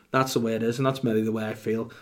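ordinary talk, nothing marked

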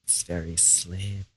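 hissing snake impression, very soft; a bad hissy snake. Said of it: "That was a terrible snake impression".

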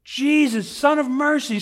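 high, loud desperate cry; acted shout for help; yelling. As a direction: yell to be heard over a crowd. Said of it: "starts yelling".

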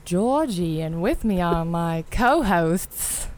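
put-on slow, lightly Australian accent. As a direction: drawl it slowly in an Australian accent. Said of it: "That was Australian".

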